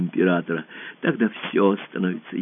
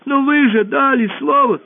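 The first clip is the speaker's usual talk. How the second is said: high squeaky pleading voice; ball begging the emperor for its family, squeaking; 3 dB louder than usual. Acted. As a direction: squeak and beg, small and desperate. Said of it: "пропищал мяч".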